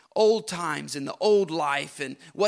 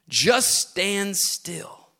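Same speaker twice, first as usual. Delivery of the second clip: usual speaking voice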